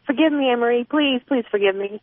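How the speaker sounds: playful theatrical begging. A narrator's reading. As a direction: beg forgiveness like a mock prayer. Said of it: "he begs".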